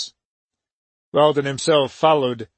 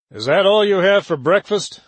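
scowling question; 5 semitones higher, 4 dB louder than usual. A narrator's reading. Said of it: "Weldon asked, scowling".

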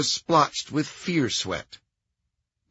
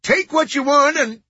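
loud, very high frightened babble; terrified nasal surrender; high-pitched nasal panic; 14 semitones higher, 7 dB louder than usual. A narrator's reading. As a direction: plead with a robber in a nasal voice. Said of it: "High-pitched, nasal voice".

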